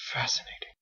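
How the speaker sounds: soft breathy whisper of wonder; awed whisper. Acted. Captain awed by the Gunder, whispered. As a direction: breathe it in amazement.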